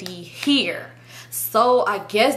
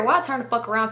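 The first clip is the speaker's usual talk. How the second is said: ordinary talk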